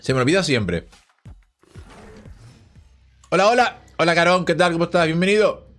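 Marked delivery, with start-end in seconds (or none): none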